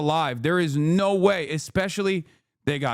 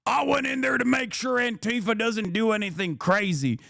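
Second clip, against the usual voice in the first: imitating a gun guy; 5 semitones higher than usual. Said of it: "a hog that was like".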